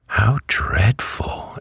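shocked, high, drawn-out exclamation. A narrator's reading. Dorothy hears the hen eats bugs, shocked. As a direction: react aghast, high and slow. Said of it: "exclaimed Dorothy in a shocked voice".

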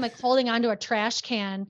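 ordinary delivery

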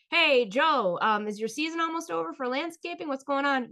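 high, loud, eager small-talk voice; forced cheerful question. Retold or imitated. Asking Joe about landscaping, forced cheer. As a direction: keep the chatter going, overly bright. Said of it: "One word answer".

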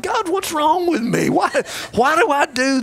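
high-pitched, half-laughing lament; mock choked-up plea. Pastor retelling crying at movies, high and mock-tearful. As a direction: play up the tearful bafflement. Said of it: "I'm choking back tears".

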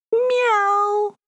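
high cat meow. Shown as a demonstration. Cat sound demo.

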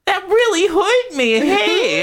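very high, whiny hurt voice; squeaky monster voice. Acted. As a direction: whine like a pathetic monster. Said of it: "why did he sound like that".

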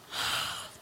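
imitated child's gasp of wonder; awestruck gasp. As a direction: draw in a breath, mock awe.

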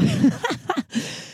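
high, soft giggling laugh; amused laughter bubbling out; real laugh. Real, not acted. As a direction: break into a helpless giggle. Breaks up over convoluted grim story.

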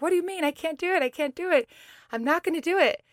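high, insistent mock refusal; indignant protest. Planker refusing the trainer's challenge, high indignant protest. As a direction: act out a high-pitched, stubborn objection.

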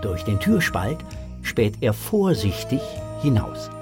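usual speaking voice

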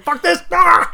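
high, loud comic outburst; sudden high-pitched mock freak-out; mock panicked yell. Acting out the exposed runner's panic.

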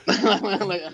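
high, laughing lead-in. Real, not acted. Telling on himself after an oh-shit moment, laughing. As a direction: crack up while telling it. Laughing at his own story.

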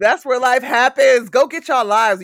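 high, booming hype-woman shout; excited, full-volume cheering on; loud hype. Real, not acted. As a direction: belt out the guest's tagline to fire up listeners. Hyping guest's tagline to listeners.

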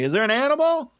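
high, loud, panicky squeak; startled high-pitched nervous voice; scared Derek. Retold or imitated. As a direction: Derek jumping at a noise in the house. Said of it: "and he's just like".

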